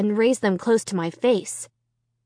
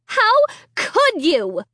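accusing; 12 semitones higher, 4 dB louder, much slower than usual. A narrator's reading. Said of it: "Sarah screamed".

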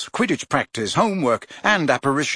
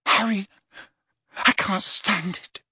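put-on slow, pained boy's voice; a hint of a tormented teenager; lovesick despair; 7 semitones higher than usual. A narrator's reading. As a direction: confess it in anguish. Ron in love-torment.

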